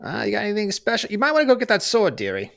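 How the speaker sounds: taunting. Acted. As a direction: goad him like a cocky granny. Old brawler taunting.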